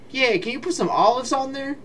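high-pitched, hopeful customer asking; naive customer. Acted. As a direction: put on a high voice for a silly request. Voicing a customer's silly order.

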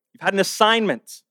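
normal talking voice

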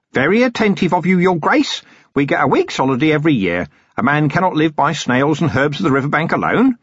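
a cheerful, long-winded hermit eager to explain; chatty old hermit. Acted. Hermit answers Vimes.

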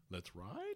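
puzzled newsreader rise on RIDE; question intonation. Retold or imitated. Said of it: "if they would have put a question mark ... read it like Ron Burgundy".

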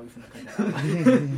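边笑边说，语速放慢; 笑着慢慢重复一遍; laughing. Real, not acted.

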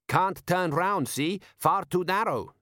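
high, slow donkey voice of authority; know-all donkey expert. A narrator's reading. Elevated donkey expert stating why he is stuck, know-all. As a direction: explain it slowly, pitched high. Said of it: "said the elevated donkey expert".

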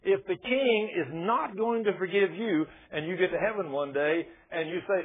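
ordinary talk

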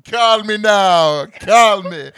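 loud, high, drawn-out call; hammed-up catchphrase. Quoting a sitcom to the co-host, loud and hammed-up. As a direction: belt out the catchphrase playfully, stretching it.

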